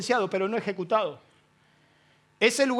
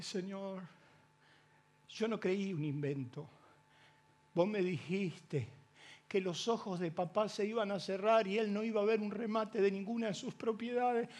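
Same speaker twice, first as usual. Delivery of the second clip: much softer, a hushed plea through tears; tearful prayer; 9 dB quieter than usual. Retold or imitated. A son begging God quietly, softly through tears. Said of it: "entre lágrimas y dolor le dije".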